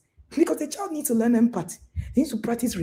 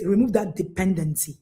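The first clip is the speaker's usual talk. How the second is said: ordinary talk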